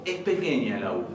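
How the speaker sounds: normal talking voice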